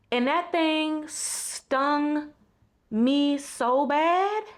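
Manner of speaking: slow, drawn-out and heartfelt. Real, not acted. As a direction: let it sink in slowly. Sincere reflective realization.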